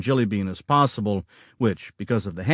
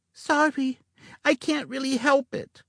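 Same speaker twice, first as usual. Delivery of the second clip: put-on sheepish little girl; 3 dB quieter than usual. A narrator's reading. Jilly apologizes, not looking up.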